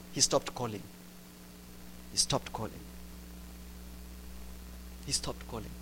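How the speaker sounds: fading repetition. Real, not acted. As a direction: let it fade into silence. Repeated for effect, fading each time.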